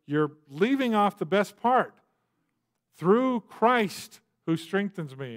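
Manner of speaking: Through Christ slowed and stressed, each word weighed; insistent correction. Retold or imitated. To a movie that drops the verse, an insistent slow stress. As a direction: correct the movie by leaning on the missing words. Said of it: "I go".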